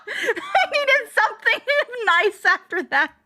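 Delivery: laughs through the words; laughing. Real, not acted. Laughing at her own sudden singing. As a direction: giggle while saying it.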